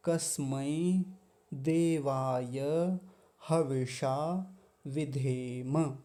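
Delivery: each Sanskrit word said slowly, one at a time; slow word-by-word recitation. Shown as a demonstration. Teacher reviewing the mantra word by word, slow. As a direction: read the line word by word, slowly. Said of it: "एक बार फिर से देख लेंगे".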